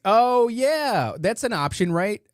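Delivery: a sudden oh-right lift; surprised realization. Real, not acted. Reading a chat suggestion aloud, high surprised lift. Reacting to chat's Turo suggestion.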